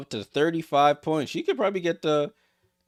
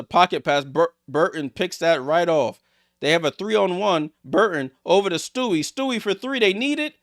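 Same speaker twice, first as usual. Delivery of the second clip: excited call; 5 semitones higher, 6 dB louder than usual. Real, not acted. Fast break, live call.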